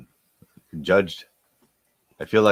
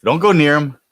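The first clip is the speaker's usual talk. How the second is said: normal talking voice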